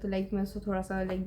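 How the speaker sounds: ordinary talk, nothing marked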